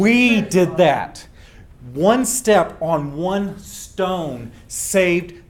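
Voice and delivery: WE stressed and slow; proud, slow and weighty; slow emphatic. Real, not acted. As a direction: land each word slowly. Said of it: "emphatic slow repeat".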